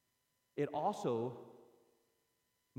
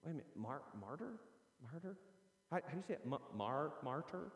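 puzzled listener; 9 dB quieter than usual. Retold or imitated. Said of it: "you're thinking".

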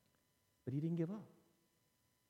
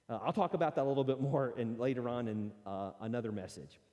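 ordinary talk, nothing marked

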